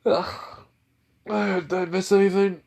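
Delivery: groggy just woken. Acted. Deku waking from his nap, groggy and dazed. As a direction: sound like you're still half asleep. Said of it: "a little bit groggy after that nap".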